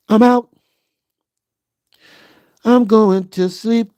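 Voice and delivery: mild drowsy bedtime chant; sleepy sing-song. Pretend. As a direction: stretch each phrase out like a lullaby.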